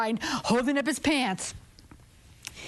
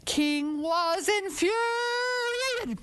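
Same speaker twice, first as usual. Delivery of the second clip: slow high build-up; 9 semitones higher, much slower than usual. A narrator's reading. Storyteller to children, making the king's rage grand, slow and high. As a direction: draw out infuriated slowly and high. Narrator stretches the fury.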